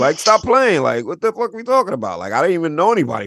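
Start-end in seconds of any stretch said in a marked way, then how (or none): 0.0-2.2 higher and louder, incredulous; can't believe people think that; exasperated outburst. Real, not acted. Exasperated outburst about Long Island.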